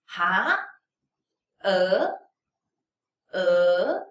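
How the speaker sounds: "e" starting low and gliding up; rising second tone. Shown as a demonstration. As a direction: swoop "e" upward like a questioning "Ha". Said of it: "Rising tone".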